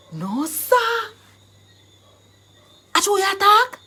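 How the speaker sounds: loud, high-climbing exclamation; acted burst of excitement; excited disbelief. Shown as a demonstration. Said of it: "And it's all excited".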